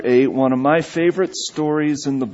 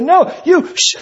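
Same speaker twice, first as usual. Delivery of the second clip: much higher, louder indignant snap; indignant retort; 13 semitones higher, 4 dB louder than usual. Retold or imitated. Woman woken from a doze retorting to him, indignant. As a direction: fire it straight back at him.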